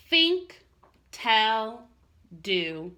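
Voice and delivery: Think, tell, do each set apart with pauses; three words stressed. Acted. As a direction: tick off "Think", "tell", "do" one by one. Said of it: "three little words".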